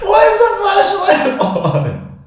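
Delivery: high, slow, through laughter; laughing. Real, not acted. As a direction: squeak it out laughing. Laugh heard on line.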